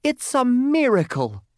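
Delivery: very high, loud little voice, thrilled; exultant talking lump of coal; amazed cry. A narrator's reading. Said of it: "cried the lump of coal".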